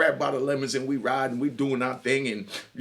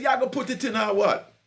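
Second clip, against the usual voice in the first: put-on Jamaican accent; 6 semitones higher than usual. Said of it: "She was a little Jamaican".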